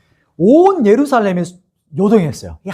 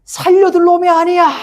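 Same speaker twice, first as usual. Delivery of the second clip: high, sustained imitation of an outcry; mock mob shout; 11 semitones higher than usual. Mob demands Paul's death as he is carried off, mock shouting. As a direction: cry it out like an enraged crowd. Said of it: "막 계속 소리 지르는 거야".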